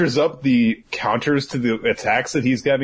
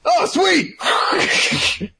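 delighted exclaim; 12 semitones higher than usual. Acted. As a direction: squeal with pleasure. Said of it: "And he's like".

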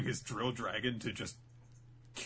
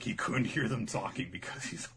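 usual speaking voice

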